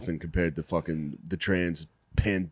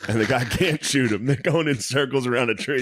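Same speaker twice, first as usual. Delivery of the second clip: normal talking voice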